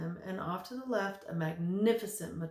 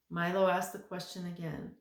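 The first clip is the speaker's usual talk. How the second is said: ordinary delivery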